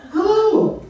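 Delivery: high, loud sing-out greeting; put-on high maiden voice; maiden's greeting. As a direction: play a maiden calling hello. Maiden greets the bear again.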